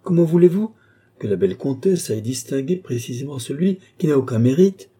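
high, loud impassioned plea; ardent, actorly protest; fiery tirade. A narrator's reading. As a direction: declaim with theatrical fire. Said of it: "débitée avec un feu".

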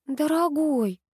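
high, meek appeal to a husband; faltering plea. A narrator's reading. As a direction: babble it pleadingly in a high voice. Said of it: "пролепетала Нинель".

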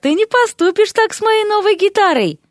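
very high-pitched, wheedling objection; squeaky-high appeal; pleading protest. Acted. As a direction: plead for your beloved guitar. Derek pleads for his guitar.

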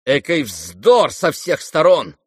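exasperated, emphatic rebuff; indignant retort. Acted. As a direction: cut them off with indignation. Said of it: "отрезала в негодовании Варя".

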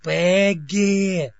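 loud call for the secretary; shouting. A narrator's reading. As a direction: shout her name. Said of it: "закричал мистер Диммак".

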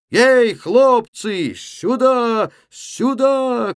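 high, loud, long-drawn holler; calling out. A narrator's reading. Левко waving the lads back to him, calling out. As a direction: summon them from afar, stretching the vowels. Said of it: "кричал он".

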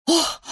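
high, short gasp of shock; crowd's breathy "oh"; exhaled gasp. A narrator's reading. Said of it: "выдохнула толпа".

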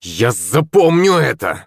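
slow, drawn-out threat with a stress on запомню; threatening yell. A narrator's reading. Humiliated schoolboy yells a threat at the girl, slow and menacing. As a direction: hurl it back as a vow of revenge. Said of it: "закричал Шепелев".

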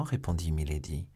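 usual speaking voice

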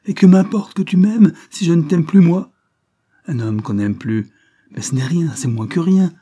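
furious outburst. Acted. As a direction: hurl it with contempt. Furious woman's climax. (colère méprisante, presque criée)

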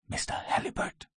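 voiceless, very soft query; hushed call. A narrator's reading. Captain seeks the fugitive at night, in a hushed whisper. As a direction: whisper the name cautiously into the dark.

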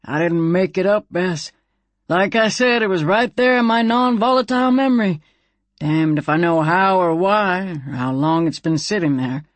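folksy character voice, insisting; defensive gun character, pitch lifting in places; Slim, defensive talking gun. Acted. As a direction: protest in a put-on character voice. Slim answers Bess defensively.

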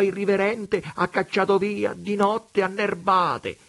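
high, loud mocking vagabond voice; vagabond Preola. A narrator's reading. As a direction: preach it like a sly tramp at God. Preola's voice in the story.

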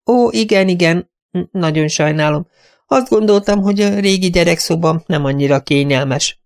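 high, apologetic, flustered voice; fluttering apology, pitched high; flustered companion. Acted. Companion apologizing to her stern mistress, high and flustered.

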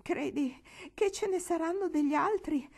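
ordinary delivery

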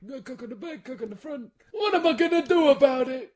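a kvetch building to a loud, high wail; Jackie Mason impression. Carrying on the bit to the co-host: mock Jackie Mason. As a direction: pile on the comic complaint to a loud finish. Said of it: "Jackie Mason is it I'm doing".

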